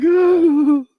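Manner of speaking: very high, loud wailing "oh"; loud mock crying wail; acted-out bawling. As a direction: howl it like someone sobbing uncontrollably. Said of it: "I started bawling".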